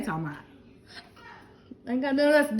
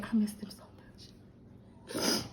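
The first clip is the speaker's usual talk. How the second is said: emotional whisper; 2 semitones lower, 11 dB quieter than usual. Real, not acted. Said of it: "I'm emotional right now".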